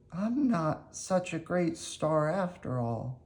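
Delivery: put-on high, soft, slow downcast boy voice; unhappy Pinocchio. Pinocchio after the crowd laughs at him, soft and sad. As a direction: quietly let the disappointment show, high and slow. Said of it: "Pinocchio was very unhappy".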